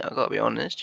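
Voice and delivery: ordinary talk, nothing marked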